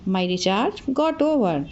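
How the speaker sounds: "my recharge got over" said very slowly, word by word; slow, clear drill of "my recharge got over"; slow teaching repeat. Shown as a demonstration. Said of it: "तो मेरा recharge ख़त्म हो गया".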